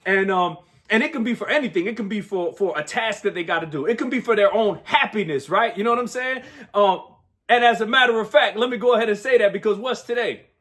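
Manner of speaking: ordinary talk, nothing marked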